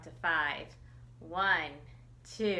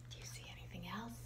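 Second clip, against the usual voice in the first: whisper; 13 dB quieter, much faster than usual. Real, not acted. Reader whispers to children.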